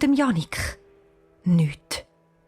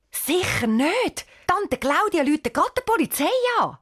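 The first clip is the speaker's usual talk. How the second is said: insistent; 10 semitones higher than usual. Acted. Refusing to tell the aunt.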